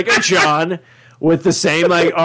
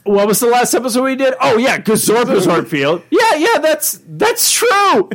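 higher, laughing, rambling improv voice; Justin Roiland impression; 6 semitones higher than usual. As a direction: play the creator giggling through an unscripted line. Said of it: "He sits there going".

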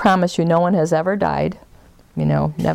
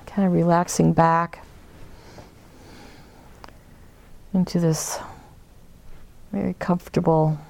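guided meditation. Acted. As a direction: ease them inward, unhurried.

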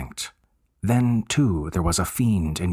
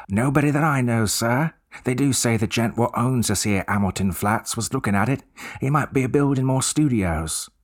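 higher Cockney voice of an eager bellboy; 5 semitones higher than usual. Acted. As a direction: play the chatty Cockney servant, keen to help.

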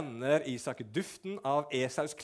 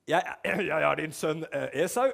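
halting, throat-clearing fake confidence; nervous lie; 6 dB louder than usual. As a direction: stumble through the lie to your father. Jakob lying to blind father.

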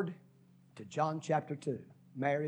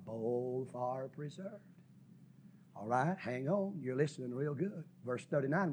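ordinary talk, nothing marked

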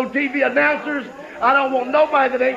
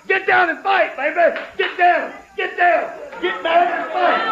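high, hammering taunt; 6 semitones higher than usual. Real, not acted. Wrestler taunting the disc jockeys to fight, high hammering taunt. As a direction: challenge them to get down and fight.

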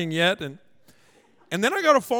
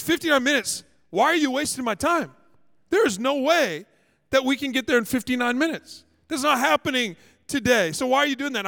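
higher-pitched exasperated retort; irate driver; 5 semitones higher than usual. Retold or imitated. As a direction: imitate a driver refusing an absurd request. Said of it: "he shoots a look at me".

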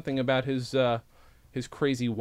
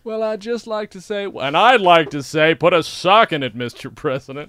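a mock Clinton telling someone off; Clinton impression; 3 semitones higher, 11 dB louder than usual. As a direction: belt it out like a folksy president. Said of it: "It's me, former President Bill Clinton".

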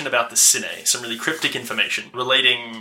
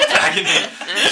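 slow, breathy laugh through the words; 7 dB louder, much slower than usual. Real, not acted. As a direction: giggle out the phrase. Laughing at a joke.

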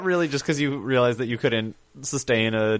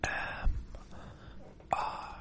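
slow, fully whispered disclaimer; ASMR whisper; 8 dB quieter than usual. Acted. Host giving listeners a disclaimer before normal speech, whispered. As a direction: whisper it slowly, close and hushed.

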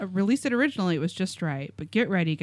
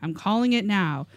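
usual speaking voice

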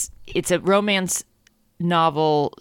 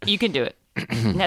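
normal talking voice

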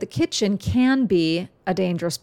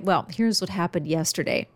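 ordinary talk, nothing marked

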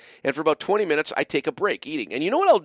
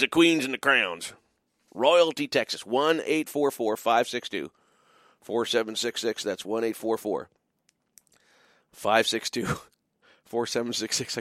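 ordinary talk

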